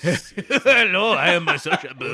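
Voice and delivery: put-on Japanese-Russian accent. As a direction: ham up a Japanese-Russian hitman introduction. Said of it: "it's like a Japanese Russian".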